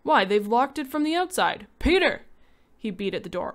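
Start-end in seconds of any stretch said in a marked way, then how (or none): 0.0-2.2 high, loud alarmed shout; father trapped, shouting for his son; panicked shout. Acted. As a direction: bellow in rising panic.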